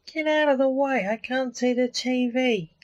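high, whiny grumble; complaining. A narrator's reading. The ghost complains Lance blocks the TV, whiny. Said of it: "the ghost complained".

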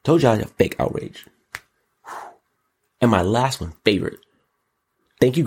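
normal talking voice

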